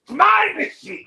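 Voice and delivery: high, loud my machine; Kenny whining to Tony, mock shout; mock whiny shout. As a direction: complain like a kid to Tony. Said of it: "he's sitting there going".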